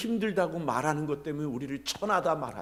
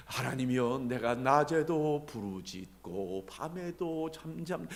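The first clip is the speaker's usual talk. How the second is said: slow, subdued recital; 2 semitones lower, slower than usual. Shown as a demonstration. Preacher shows how David would not have prayed, slow and subdued. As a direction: recite it slowly, far too meekly for a protest. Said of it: "이렇게 얘기하는 걸까요?".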